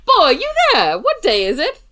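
high, loud hammy Scrooge calling out; silly clown Scrooge. Retold or imitated. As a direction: shout down to the boy like Scrooge. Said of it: "we're like".

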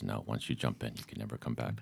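low, very quiet movie quote; gently imitating a grave refusal; hushed retold warning. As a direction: quote it softly, dropping low. Retells mermaid's grave answer.

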